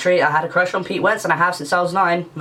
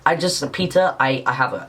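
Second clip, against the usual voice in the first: ordinary talk, nothing marked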